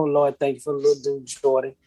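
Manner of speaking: normal talking voice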